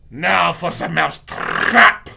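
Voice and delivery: loud, slow witch yell; villain witch's triumphant cry; Grand High Witch yelling. Acted. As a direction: yell with gloating relish, trilling into the last word. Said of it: "I heard the Grand High Witch yelling".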